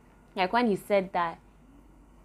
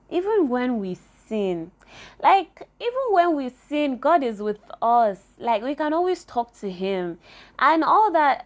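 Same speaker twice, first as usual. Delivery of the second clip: rising higher and louder with real fervour; passionate; 5 semitones higher, 7 dB louder than usual. Real, not acted. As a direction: preach it out, swept up in feeling. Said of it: "I'm getting so passionate about this".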